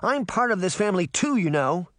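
put-on high-pitched little-girl indignation; indignant anger. A narrator's reading. Little sister tells off the one who left her behind, indignant. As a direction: protest hotly at being left out, high and quick. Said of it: "I'm really mad".

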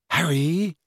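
tentative, concerned query; anxious. A narrator's reading. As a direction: call the name with a touch of anxiety. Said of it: "said Hermione in an anxious voice".

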